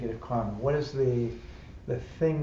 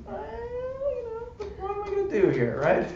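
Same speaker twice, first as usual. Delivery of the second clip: mock helpless. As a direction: ham up a baffled shrug. Mocking own helpless reaction at work.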